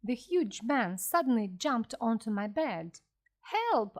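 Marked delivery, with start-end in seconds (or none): 3.4-4.0 high, sudden call for help; shouted cry for help. A narrator's reading. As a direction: cry out in alarm, pitch leaping up. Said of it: "I shouted".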